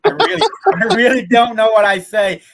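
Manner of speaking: giggling while admitting she can't understand herself; laughing. Real, not acted. Laughing at own country accent.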